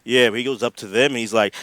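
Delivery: ordinary talk, nothing marked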